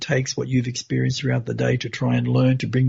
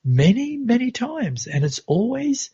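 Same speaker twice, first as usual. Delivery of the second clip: much higher, lifted repeats of many; a father marvelling, pitched up on Many, many times; a lightly voiced proud father, pitch leaping up; father's delighted exclaim; 10 semitones higher than usual. Father voiced in retold dream.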